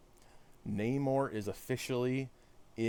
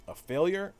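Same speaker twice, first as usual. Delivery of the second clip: higher, rising, taken-aback question; affronted question; 7 semitones higher, 3 dB louder than usual. Acted. Streamer voicing villain's reply to Captain America, affronted question. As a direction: echo it back as an indignant question.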